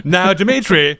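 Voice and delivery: high, loud mock placating; gently chiding a caller, hammed up; imitating Sellers' Dimitri. Co-host quoting the President calming the Soviet premier, mock-placating. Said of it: "Just the way he says Dimitri".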